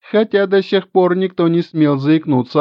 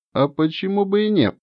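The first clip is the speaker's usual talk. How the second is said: ordinary delivery, nothing marked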